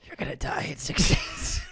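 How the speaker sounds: eerie whisper, soft; hushed mock death threat; creepy horror-film voice. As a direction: whisper it like a horror-movie curse. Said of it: "Is that the ring?".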